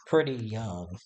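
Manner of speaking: usual speaking voice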